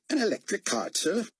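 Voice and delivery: a hint of an Aussie motor pool man; Australian accent. A narrator's reading. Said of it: "his accent making David guess he was an Aussie".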